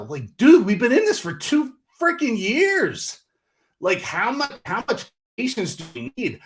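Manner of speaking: exasperated impatience. Real, not acted. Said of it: "I'm impatient".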